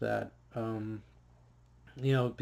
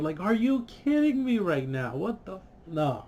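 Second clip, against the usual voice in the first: higher, exasperated outburst; outraged; 6 semitones higher than usual. Real, not acted. Frustrated fan ranting about the ending, higher outburst. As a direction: throw up your hands in disbelief.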